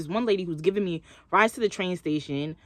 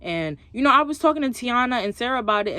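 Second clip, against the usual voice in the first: usual speaking voice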